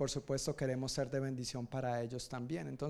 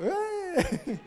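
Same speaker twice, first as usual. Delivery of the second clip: sudden high yelp at a slip; a high startled "eh" laughing at himself; high surprised yelp with a laugh; 6 dB louder than usual. Real, not acted. Stumbles over name, laughs.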